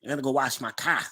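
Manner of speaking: teasing Boston imitation; Boston accent. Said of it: "Boston people say".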